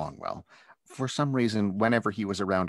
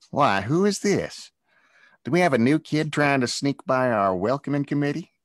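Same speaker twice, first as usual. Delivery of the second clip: sneering; 7 semitones higher, 7 dB louder than usual. Acted. Bully sneering at the new kid by the door. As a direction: toy with him like a cat with a mouse. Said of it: "the boy said with a sneer".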